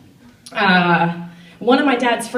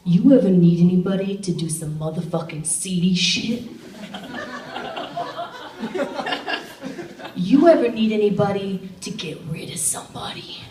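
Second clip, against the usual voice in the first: creepy dad's friend. Retold or imitated. Dad's friend murmuring a shady offer. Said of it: "my dad's friend leans into me and says".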